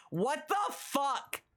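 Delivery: shocked. Real, not acted. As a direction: drag it out in horror. Reacting to ball maxing news.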